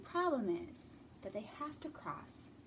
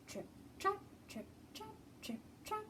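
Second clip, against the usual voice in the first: little goat footsteps; 10 semitones higher, 9 dB quieter than usual. Acted. Little goat crossing bridge.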